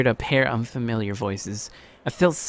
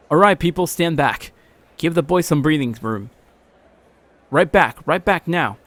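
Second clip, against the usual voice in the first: louder, firm orders to step back; bossy crowd command; 4 semitones higher, 6 dB louder than usual. Acted. The man ordering the crowd to give the boy room. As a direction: clear the space like a take-charge bystander.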